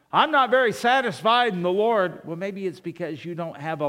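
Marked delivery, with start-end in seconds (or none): 0.1-2.2 raised, higher complaining voice; mock complaint. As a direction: protest loudly as an imagined discontented churchgoer. Voicing a discontented churchgoer's complaint.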